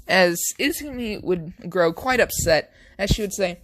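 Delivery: ordinary delivery, nothing marked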